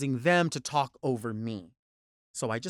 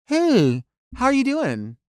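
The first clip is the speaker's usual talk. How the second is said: higher, louder breezy waitress greeting; casual diner waitress; 9 semitones higher, 6 dB louder, slower than usual. Retold or imitated. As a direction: lightly play a laid-back Midwest waitress. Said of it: "a waitress from Middle America. Just cool".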